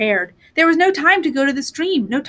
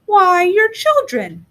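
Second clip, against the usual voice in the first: high, slow, warmly surprised crone; a sweet granny discovering guests; kindly old woman; 7 semitones higher, slower than usual. Acted. The old woman greets the children, slow and high.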